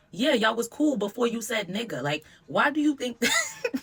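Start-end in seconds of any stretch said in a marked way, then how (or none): 2.5-3.8 laughing. Real, not acted. Incredulous laugh mid-rant.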